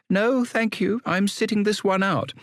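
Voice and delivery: put-on high, polite young woman; a lady politely refusing; woman's voice. A narrator's reading.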